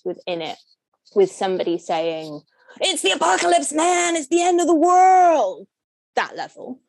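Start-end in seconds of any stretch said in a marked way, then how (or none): 2.8-5.7 high, loud American movie panic; American accent. Acted. Speaker mimics disaster-film character announcing doom, American accent. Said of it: "that was a great American accent".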